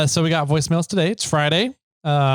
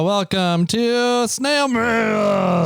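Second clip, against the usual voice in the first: drawn-out shouted show name; announcer intro; 5 semitones higher, much slower than usual. Acted. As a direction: boom out the show title slowly.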